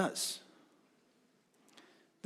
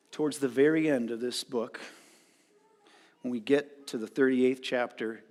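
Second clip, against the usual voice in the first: normal talking voice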